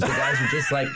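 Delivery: ordinary talk, nothing marked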